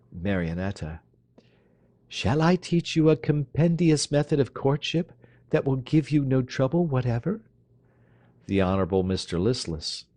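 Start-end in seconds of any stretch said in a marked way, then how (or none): 2.1-7.4 high, arch teasing voice; teasing young lady. Acted. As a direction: tease the dandy. Marionetta teasing.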